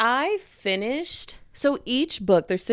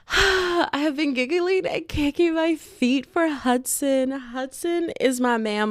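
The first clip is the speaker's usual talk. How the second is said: high, slow lovestruck gushing; swooning; 6 semitones higher, slower than usual. Real, not acted. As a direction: gush it slowly, high in the voice. Swooning over fictional crush Hudson.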